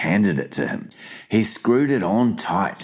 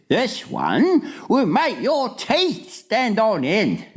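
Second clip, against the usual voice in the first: high, slow, dramatic warning; alarmed giant; slower than usual. A narrator's reading. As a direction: draw out the horrors to scare. Giant warns Sophie.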